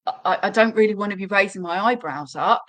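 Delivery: ordinary delivery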